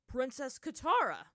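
loud, high cry of surprise; exclaim, stunned to see who it is; stunned exclamation. A narrator's reading. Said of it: "Bato exclaimed, stunned".

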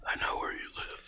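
low unvoiced menacing whisper; creepy whisper. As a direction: whisper it like a stalker.

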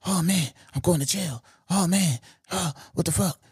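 a hint of frantic panic; mock panic. Trapped robber realizing he's going to jail, mock panic. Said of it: "you hear him panicking".

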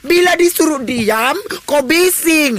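hammed-up rant at the netizens; angry rant. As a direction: tell the netizens off, fed up. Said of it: "Janganlah kau marah begitu sama netizen".

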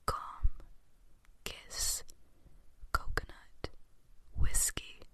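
slow whispered words with long pauses; ASMR trigger words. Shown as a demonstration. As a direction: whisper each word slowly, one by one. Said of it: "I also just looked up some trigger words for A S M R".

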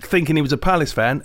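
normal talking voice

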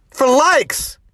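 high, loud, drawn-out disbelief; slow and shouting, disgusted; raging at parents chasing likes; passionate outraged yell. Real, not acted. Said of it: "very passionate about this stuff".

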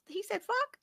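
high, incredulous question, the pitch shooting up; disbelieving mom quizzing her kid; retold shock. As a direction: act out being floored. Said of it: "I said".

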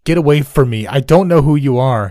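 frantic, loud, high yell; panicked shouting man. A narrator's reading. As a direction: scream it at strangers in panic. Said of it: "he yelled".

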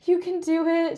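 sympathetic aunt. Retold or imitated. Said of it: "giving me the most sympathetic eyes".

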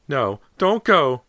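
loud, high, drawn-out scream. A narrator's reading. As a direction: scream to stop him. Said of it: "Missus Fraser screamed after him".

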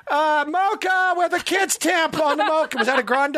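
high, chirpy barista call. Retold or imitated. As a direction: call out the order brightly. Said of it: "they go".

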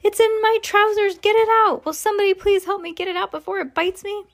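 high-pitched frantic plea; panicked shouting. A narrator's reading. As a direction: beg for help in comic panic, high. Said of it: "stupid cooks still shouting".

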